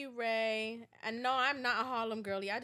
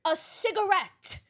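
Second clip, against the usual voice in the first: loud, high, relishing rant; excited raving; 5 semitones higher, 11 dB louder than usual. Real, not acted. Raving about cigarettes with coffee, loud and excited. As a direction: belt it out with relish.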